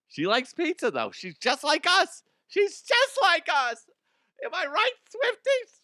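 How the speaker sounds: very high, squealing fangirl voice; mock fan squeal. Host mocks his own bit, squealing fangirl voice. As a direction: gush like a giddy fan.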